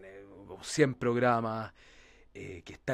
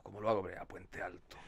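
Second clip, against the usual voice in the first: softer question sinking down to a low, quiet "Puente Alto"; falling tune; 4 semitones lower, 5 dB quieter than usual. Shown as a demonstration. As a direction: let "Puente Alto" drop away at the end. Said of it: "bajando, siempre bajando". (pregunta que baja hasta quedar grave y suave en "Puente Alto")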